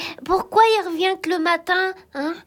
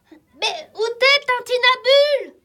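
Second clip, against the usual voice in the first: high, raised call into the dark; 5 semitones higher, 3 dB louder than usual. Acted. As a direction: call loudly for a missing companion. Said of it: "ne crie pas comme ça".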